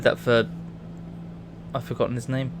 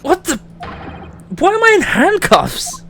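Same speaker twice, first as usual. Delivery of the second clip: shocked disbelief; 14 semitones higher than usual. Acted. Protagonist voiced, caught in handcuffs.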